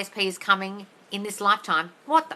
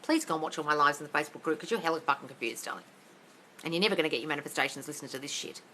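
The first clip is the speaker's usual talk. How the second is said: ordinary delivery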